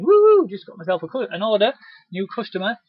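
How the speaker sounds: high, loud little whoop of delight; excited whoop. Retold or imitated. Rep cheering to themself over a new customer, excited. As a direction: celebrate the new order out loud.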